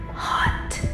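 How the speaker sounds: soft, whispered confession; sultry whisper. Acted. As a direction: let the word out as a whisper. Queen admits arousal.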